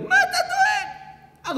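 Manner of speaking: high, drawn-out comic squeal; squealed mock question. Bragging expert reassures a client, squealed high.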